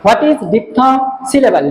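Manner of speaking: very slow, word by word, as if for copying down; slow dictation. Shown as a demonstration. Teacher dictating a question for students to copy, very slow. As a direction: dictate it slowly so students can write. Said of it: "তাহলে যদি বলা হয়".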